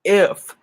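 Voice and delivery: "If" set apart, loud and punched; stress on IF. Real, not acted. Speaker qualifying her point to viewers, stressing "If". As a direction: hit "If" hard as a lone word. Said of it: "I emphasize the word if".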